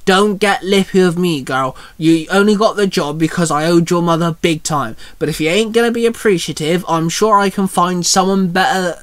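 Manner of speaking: usual speaking voice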